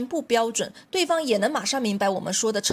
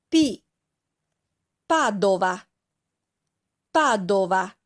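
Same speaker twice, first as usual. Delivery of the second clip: slow, word-by-word model reading with long pauses and each stressed syllable punched, as in miLAno, NApoli; stressed syllables demonstrated; much slower than usual. Shown as a demonstration. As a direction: read it out for learners to repeat, leaning on each stressed syllable. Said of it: "注意每个单词的重音".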